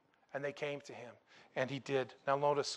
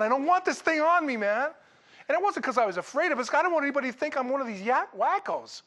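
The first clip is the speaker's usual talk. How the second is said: worked up; 9 semitones higher, 5 dB louder than usual. Retold or imitated. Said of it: "I'm, like, freaking out".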